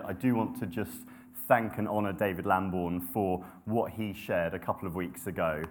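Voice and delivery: ordinary talk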